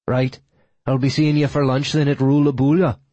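put-on Irish brogue; Irish accent with a hint of Icelandic; faux Irish accent. A narrator's reading. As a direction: make the date in fake Irish. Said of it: "his best faux Irish accent".

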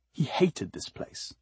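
whispered, slow disgust; hushed loathing. A narrator's reading. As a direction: breathe the words out with contempt. Narration, sudden loathing.

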